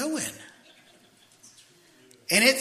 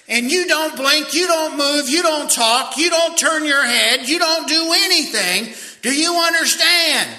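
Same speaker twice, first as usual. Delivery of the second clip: high, loud, slower hammered commands; drill instructor yelling; 9 semitones higher, 7 dB louder than usual. Retold or imitated. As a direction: hammer each don't home. Drill instructor rules shouted.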